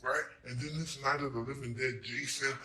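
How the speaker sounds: usual speaking voice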